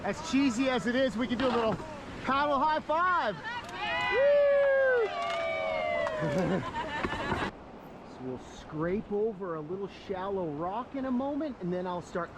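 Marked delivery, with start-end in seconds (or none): 4.1-5.1 high, loud cheer; excited whoop. Real, not acted. Paddle high five cheer. 5.1-8.1 high laugh. Real, not acted. Laugh after the high five.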